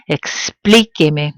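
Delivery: explíqueme, slowly, weight on PLÍ; explíqueme as one word, stressed ex-PLÍ-que-me; original verb stress. Shown as a demonstration. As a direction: stretch explíqueme, hitting PLÍ. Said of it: "maintain the original stress of the verb".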